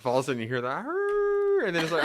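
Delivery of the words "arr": spooky roar. Retold or imitated. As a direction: belt out a cartoon growl. Spooky video sound imitated.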